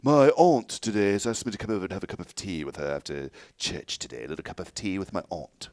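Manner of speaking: put-on refined tea-party voice; mock genteel voice, ahnt and a little cup of tea; mock sophisticated. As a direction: play the prim lady going to tea with her aunt. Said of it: "It sounds too sophisticated".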